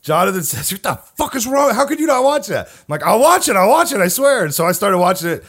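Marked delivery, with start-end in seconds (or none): 0.7-2.7 high-pitched imitation of indignant friend; mock outrage. Retells friend's outraged reaction. 3.0-4.4 high, loud, insistent promise; mock capitulation. Retells giving in under pressure.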